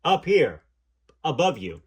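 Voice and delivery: big, loud voice from above; booming voice. Retold or imitated. Station man praising the dancer, booming loudly. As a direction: boom it down from the sound room. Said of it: "A booming voice filled the large room".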